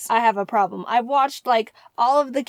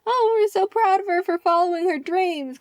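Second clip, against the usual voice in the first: gushing proud parent voice, pitched up; 8 semitones higher than usual. Retold or imitated. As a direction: play the beaming parent for the camera. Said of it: "to portray the".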